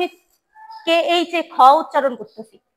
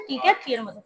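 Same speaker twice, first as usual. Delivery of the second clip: ordinary delivery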